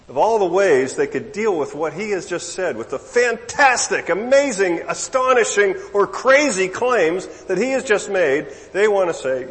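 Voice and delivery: high, loud, mounting incredulity; worked-up preacher's amazement; exasperated disbelief. Real, not acted. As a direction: pile up the adjectives, each hit hard and high. Incredulous build-up at their petty objection.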